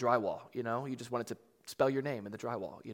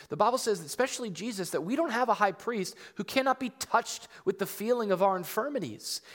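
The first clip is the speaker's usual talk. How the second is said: raised, high-pitched, insistent preaching; impassioned preaching; 7 semitones higher, 7 dB louder than usual. Real, not acted. Pastor assuring the church God shares their hurt, impassioned preaching. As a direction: preach it out loud with rising conviction.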